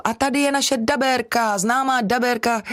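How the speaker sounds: high-pitched mock introduction of a guest; put-on, high presenter's introduction; imitating introducers. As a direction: mimic someone presenting you, pitched well up. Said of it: "tak řeknou".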